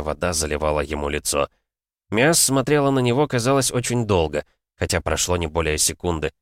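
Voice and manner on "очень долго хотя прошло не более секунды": ordinary delivery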